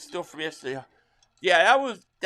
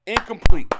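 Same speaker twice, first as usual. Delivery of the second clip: much louder triumphant yell; excited shout; 3 semitones higher, 16 dB louder than usual. Real, not acted. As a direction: cheer the stop out loud. Celebrating a stop during the game.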